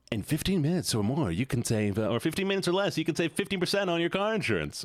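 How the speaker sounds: lightly mimicked insurance ad. Streamer quoting the Geico ad, lightly mocking. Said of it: "the voice again".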